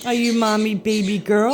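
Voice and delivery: ordinary talk, nothing marked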